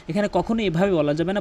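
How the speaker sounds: ordinary talk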